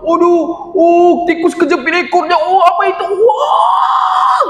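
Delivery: excited gamer shouting. Acted. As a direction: yell at the screen while playing, cheering yourself on. Bobon shouting while gaming.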